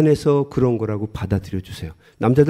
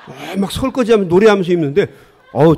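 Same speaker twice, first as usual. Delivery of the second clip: ordinary talk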